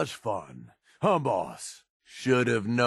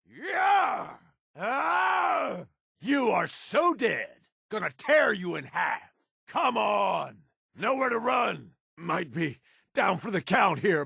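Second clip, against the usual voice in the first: battle shout; 7 semitones higher than usual. Acted. Companion taunting enemies in a gunfight, shouting. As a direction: roar threats at the enemy mid-fight.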